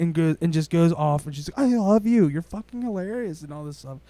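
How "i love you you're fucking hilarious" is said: high-pitched exaggerated fan gush; gushing fan, exaggerated on purpose; mock gushing fan. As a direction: overplay the fan mom. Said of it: "She didn't say it like that".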